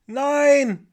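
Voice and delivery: put-on loud, high child's shout; long, high-pitched yell; shouting back. A narrator's reading. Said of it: "schrie die kleine Ida zurück".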